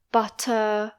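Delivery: BUTTER with a crisp T and no final R; strong British T. Shown as a demonstration. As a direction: release the T of Butter clearly. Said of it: "make the T strong".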